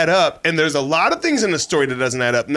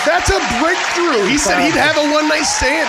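excited shout; 9 semitones higher than usual. Real, not acted. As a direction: yell it like huge news. Excited over friend's confession.

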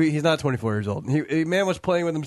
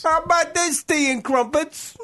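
high, squawky mock English; English accent; 13 semitones higher than usual. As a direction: pipe it up in silly English. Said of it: "try to do an English accent".

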